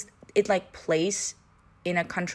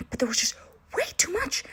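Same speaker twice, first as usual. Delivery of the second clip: whispered complaint, high; 11 semitones higher than usual. Real, not acted. Griping about too much smut, whispered. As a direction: hush it like a confession. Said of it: "I don't know why I'm whispering".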